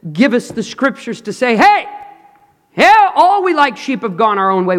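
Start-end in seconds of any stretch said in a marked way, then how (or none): 1.6-4.8 much higher, louder urgent call; prophet's cry. Retold or imitated. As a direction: sound the alarm like a prophet. Prophet crying out to people.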